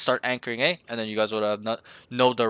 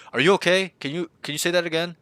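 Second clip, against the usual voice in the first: higher, louder questioning; mock puzzled teammate; 6 semitones higher, 3 dB louder, faster than usual. Coach acting teammates who couldn't hear the caller, puzzled. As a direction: imitate someone asking for a repeat.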